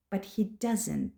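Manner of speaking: usual speaking voice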